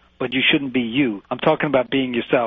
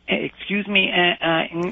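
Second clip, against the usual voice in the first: halting, higher mock of a meek customer; mock timid customer; 6 semitones higher than usual. Coach imitates student at the garage, mock timid stammer. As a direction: stammer politely, mocking a hesitant asker. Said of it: "you say".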